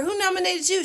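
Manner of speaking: host questioning each mother on stage, high voice; talk show host. Retold or imitated. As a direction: ask it like a peppy TV host. Host interviewing dancing mothers.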